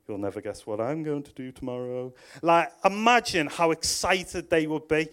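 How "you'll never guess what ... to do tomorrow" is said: Gabriel boasting. Retold or imitated. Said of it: "Angel Gabriel going".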